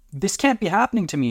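high-pitched, panicky disbelief; distressed. A narrator's reading. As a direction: wail it in disbelief. Partner horrified by his change.

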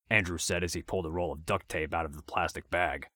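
ordinary delivery, nothing marked

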